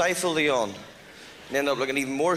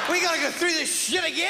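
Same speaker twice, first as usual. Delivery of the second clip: very high, far-off shouted grumble; whiny high complaint; 13 semitones higher than usual. Acted. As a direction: shout it as a fed-up paper. Recycled paper complaining.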